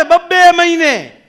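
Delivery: loud and high, slow; mock shout, slowed. Doctor repeating his complaint slowly, loud and high. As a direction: stretch out બબ્બે મહિને while yelling.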